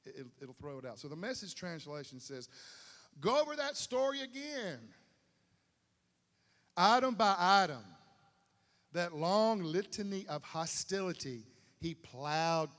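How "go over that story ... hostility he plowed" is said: dramatic reading. Acted.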